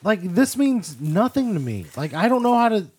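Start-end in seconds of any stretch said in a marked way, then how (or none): 0.0-1.9 mock-outraged pushback, slow and raised; exasperated refusal. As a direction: stretch it out in exasperation. Player refuses the octopus's plea.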